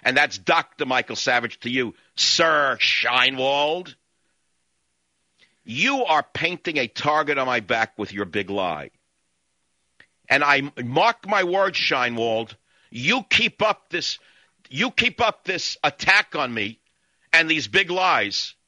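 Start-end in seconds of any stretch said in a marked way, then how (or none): 2.1-4.0 very slow, sneering "Sir"; stretched sarcastic address; mocking title. Real, not acted. 12.9-18.5 slow menacing warning; slow furious warning; angry threat. Real, not acted. Host threatening ambassador.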